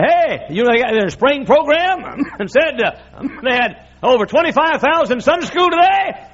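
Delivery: the Lord boasting. Retold or imitated.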